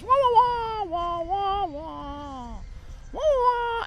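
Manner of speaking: high muffled trombone-like wah wah; muffled wah wah talk. Retold or imitated. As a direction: wah wah like a muted horn, very high. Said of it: "all I can hear is".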